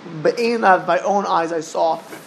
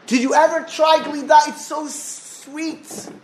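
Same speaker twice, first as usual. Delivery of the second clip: much higher and louder, gushing over sweets; excited boy; 8 semitones higher, 4 dB louder, slower than usual. Acted. Yeshiva boy tempting the sage with ice cream, high and gushing. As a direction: tempt him like a kid in a candy shop.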